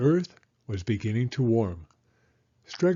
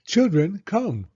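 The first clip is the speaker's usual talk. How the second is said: higher, louder call across the yard; 8 semitones higher, 6 dB louder than usual. A narrator's reading. Stregonona calling the children to come plant. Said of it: "called Stregonona".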